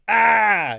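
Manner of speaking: sudden high yelled shriek of a screaming kettle; mock kettle scream. Kettle on the boil, screaming at the room. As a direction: screech like a kettle gone mad. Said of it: "just screams at you".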